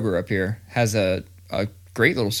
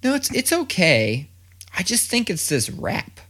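put-on higher kid voice making excuses; imitating his kid; 8 semitones higher than usual. Dad mimicking his son blaming the seaweed, higher excuse-making voice. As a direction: fib politely like a kid covering. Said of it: "he's like".

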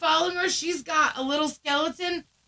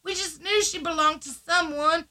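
higher, put-on Southern voice; Southern accent; 4 semitones higher, 4 dB louder than usual. Woman on the phone assuring owner the dog is safe, Southern accent. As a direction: reassure in a hammed-up Southern twang. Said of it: "You start talking Southern too?".

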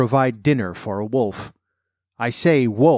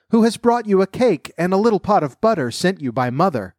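wolf imitating a little girl, much higher; counterfeit child's voice; 7 semitones higher than usual. A narrator's reading. Wolf poses as the grandchild at the door, counterfeit high voice. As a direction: put on a high-pitched granddaughter voice. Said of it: "counterfeiting her voice".

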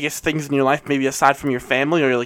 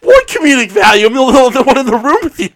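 loud, high-pitched incredulous protest; exasperated outburst; 11 semitones higher, 8 dB louder than usual. Real, not acted. Co-host as sole witness disputing the gag, exasperated. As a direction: push pitch and volume up in disbelief.

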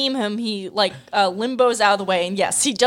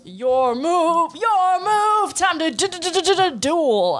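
high stuttering villain voice; hammed-up stammer into duel; mock Buu; 8 semitones higher, slower than usual. Friend mimicking Buu's taunt, high and stuttering. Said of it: "And he goes".

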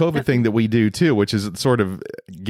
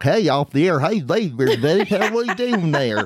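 high, drawn-out mock Southern drawl; exaggerated Southern accent; 7 semitones higher, slower than usual. Husband mocks wife's supposed Southern accent, hammed-up drawl. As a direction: ham up a slow Southern twang, pitched high. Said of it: "you're not like".

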